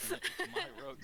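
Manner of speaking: high, playful protest; mock possessive squeal. Mock squabble over a rug.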